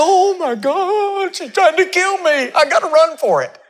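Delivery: Elijah's hammed-up high wail of fear; mock panic. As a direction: play a terrified prophet for laughs. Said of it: "he holds his note and he says".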